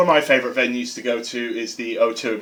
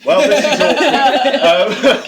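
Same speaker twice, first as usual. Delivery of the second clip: slow, drawn-out "this is awkward" with a laugh; stretched, laughing mock awkwardness; 11 semitones higher, 5 dB louder, much slower than usual. Real, not acted. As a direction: play up the embarrassment, slowly. Awkward reply to family remark.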